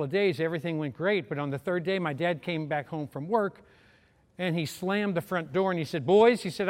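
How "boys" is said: put-on loud stern summons to the boys; a sharp loud "Boys," from the doorway; booming call. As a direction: call them in like an angry father. Angry dad after slamming door.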